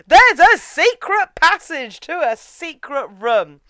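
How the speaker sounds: loud, high and slow announcement; mock dramatic reveal. Host mocking a Vatican trope, hammed-up dramatic reveal. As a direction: proclaim the trope with hammed-up grandeur.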